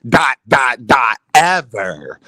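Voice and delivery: deep, drawn-out mock tweet read-out; imitating Clarissa's tone. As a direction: read her tweet low and slow, punching each dot. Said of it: "You know that's how she was saying it when she tweeted it".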